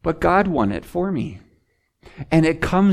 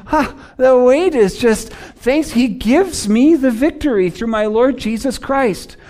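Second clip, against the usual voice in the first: loud, high, exultant cry of release; joyful relief; 7 semitones higher, 5 dB louder than usual. Acted. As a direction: toss off the burden with a triumphant "Ha". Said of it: "It's like".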